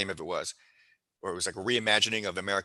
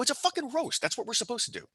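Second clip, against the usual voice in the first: much higher, defensive comeback; indignant retort. Retold or imitated. Straka snaps back at his angry friend, exasperated. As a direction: throw it back at him, exasperated.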